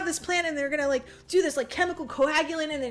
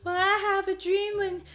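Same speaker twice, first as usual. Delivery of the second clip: higher, put-on girl's voice; girl character; 5 semitones higher than usual. Girl sharing her presidential dream with the man, raised mock voice. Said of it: "this girl is like".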